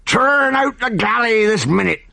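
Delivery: high frantic barked orders. Acted. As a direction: shout orders in a panic. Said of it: "shouted the captain frantically".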